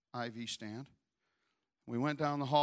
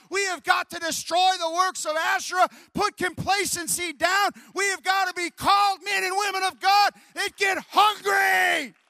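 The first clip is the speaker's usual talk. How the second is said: loud, high revival shouting; preaching at full force; 16 dB louder than usual. Acted. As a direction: whip the congregation up with fire.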